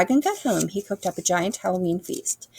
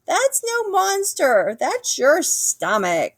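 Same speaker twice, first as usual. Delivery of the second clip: angry squirrel; 12 semitones higher, 3 dB louder than usual. A narrator's reading. Woken squirrel scolding Dragon to go home, cross. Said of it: "cried the angry squirrel".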